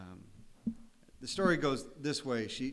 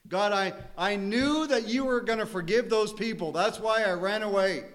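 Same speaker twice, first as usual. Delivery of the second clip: high, loud, bitter complaint to God; Jonah's loud, bitter whine; whining Jonah; 8 semitones higher, 7 dB louder than usual. Retold or imitated. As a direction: plead like a sulking prophet. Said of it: "Sometimes we're like Jonah".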